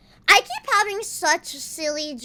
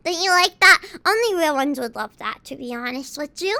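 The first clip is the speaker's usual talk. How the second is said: normal talking voice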